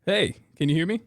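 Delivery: usual speaking voice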